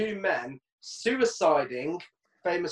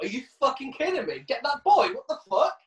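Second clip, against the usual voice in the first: mock indignant parent, higher pitch; a hint of a fuming parent; outraged parent; 9 semitones higher than usual. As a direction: play the furious mum in the park. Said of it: "You imagine you're a kid's parent".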